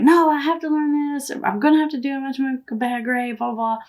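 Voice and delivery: mildly whiny retold protest of an upset boy; upset child. Son tells mom he has to study, mildly upset imitation. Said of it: "he's upset".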